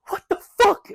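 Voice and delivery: very high, loud disbelief; retold shocked yell. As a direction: shriek it as a re-enacted reaction. Retold reaction to shotguns.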